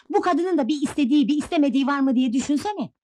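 high-pitched, aggrieved outburst; indignant protest. Acted. Wife protests being ordered about by husband, indignant.

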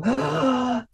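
high, drawn-out intake of breath; overwhelmed gasp, re-enacted; sharp gasp. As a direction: gasp as if hit by a wave, breath knocked away. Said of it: "And I would go".

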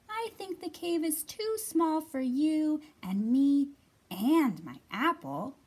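high, loud mouse squeak, slowed; squeaking Little Mouse. A narrator's reading. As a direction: stretch out each squeaky word. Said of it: "squeaked Little Mouse".

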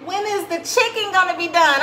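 high, put-on voice of an impatient kid; Alana's voice. Mom voicing her daughter Alana begging for chicken, high kid voice. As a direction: pitch way up like a child asking for food. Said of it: "Alana was like".

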